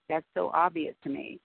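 ordinary delivery

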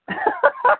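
high, loud burst of laughter. Real, not acted. Laughs after retelling sponsor's question.

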